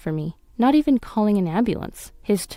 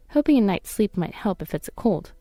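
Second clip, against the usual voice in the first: ordinary delivery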